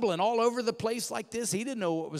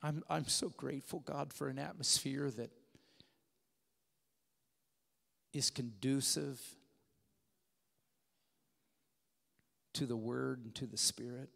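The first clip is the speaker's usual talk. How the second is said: prayer; 7 semitones lower, 11 dB quieter than usual. Acted. Closing prayer.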